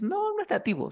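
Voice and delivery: high-pitched mock objection; acting out student's objection. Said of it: "Tú dices".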